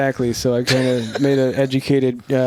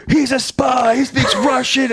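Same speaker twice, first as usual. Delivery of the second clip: alarmed shout; 11 semitones higher than usual. Retold or imitated. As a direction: shout it high like panicked cops. Police alarmed at Russian speaker.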